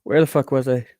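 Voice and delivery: ordinary talk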